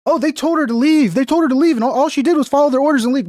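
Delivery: high, fast whiny mock voice; mocking the left. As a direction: sneer it out like a naive protester. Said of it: "Another lie the left is saying is like".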